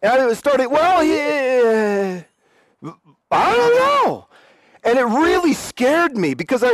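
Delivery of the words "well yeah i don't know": very high, trailing helpless squeak; hammed-up cluelessness; at a loss. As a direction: fumble and give up. Stumped by her question.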